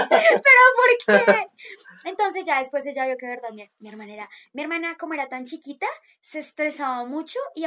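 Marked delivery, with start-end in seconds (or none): 0.1-1.5 loud, high, very slow crying wail; wailing protest. Retold or imitated. Punished child wailing to her mother, crying shout. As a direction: wail it like a wronged little kid.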